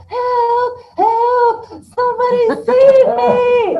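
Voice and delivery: high, loud drawn-out cry for rescue; yelling for help. Acted. Man trapped in lion's den, yelling for help. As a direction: scream it out in mock terror, stretching each word. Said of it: "And he yells".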